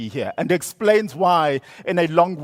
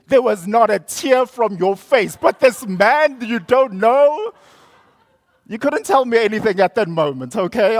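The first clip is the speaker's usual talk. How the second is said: higher and louder, a hammed-up scolding mother; livid mother; 7 semitones higher, 6 dB louder than usual. Mother berating teen for crying over a stranger, hammed-up livid scolding. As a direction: scold the child in mock outrage. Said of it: "She was absolutely livid".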